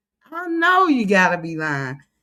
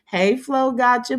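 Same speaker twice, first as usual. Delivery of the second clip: ordinary talk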